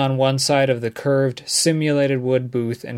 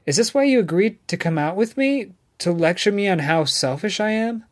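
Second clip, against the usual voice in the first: lightly voiced Megan, indignant; 6 semitones higher than usual.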